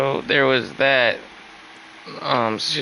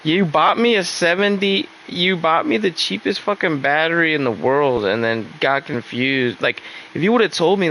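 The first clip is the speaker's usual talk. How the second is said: exasperated retort; 6 semitones higher than usual. Retold or imitated. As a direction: fire back, indignant. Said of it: "And I'm like".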